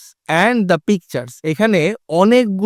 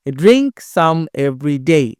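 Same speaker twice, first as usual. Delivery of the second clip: "Drink" and "day" hit with a beat, slow chant; clap-beat on "Drink" and "day", the rest light; stress on drink and day; 3 semitones lower, much slower than usual. Shown as a demonstration. Teacher showing pupils where to clap, stress on "Drink" and "day". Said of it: "drink হাততালি হবে আর ডেতে হাততালি হবে".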